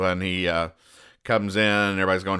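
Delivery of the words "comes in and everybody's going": usual speaking voice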